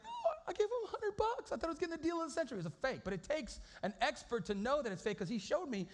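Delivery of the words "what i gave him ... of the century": high, rushed, incredulous squeal; shocked disbelief. Retold or imitated. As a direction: blurt it out like you just got scammed. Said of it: "I'm like".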